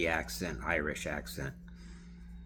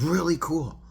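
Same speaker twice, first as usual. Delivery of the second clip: much higher, louder delighted reply; impressed gush; 11 semitones higher, 9 dB louder than usual. Retold or imitated. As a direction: wow it out. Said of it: "she's talking like this, very animated".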